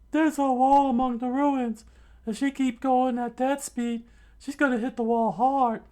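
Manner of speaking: very high, squeaky cartoon voice; Usopp's scheming thought. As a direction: plot the trap in a squeaky voice. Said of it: "Usopp thought".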